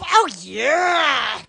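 ordinary talk, nothing marked

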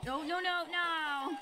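sudden alarm as you sink; alarmed cry. Real, not acted. Sinking into water.

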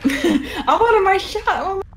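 high, slow laughing complaint; laughing whine. Real, not acted. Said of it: "I just wanted to use it".

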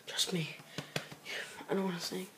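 hushed unvoiced whisper; whispered warning to viewers. Real, not acted. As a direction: lean in and whisper.

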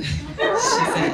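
breaking up with laughter mid-narration; reader laughing. Real, not acted. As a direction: laugh through the words.

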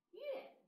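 乐 said as yuè, falling fourth tone; pronounce the character. Shown as a demonstration. Teacher repeats 乐 as yuè for the class. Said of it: "音乐的乐".

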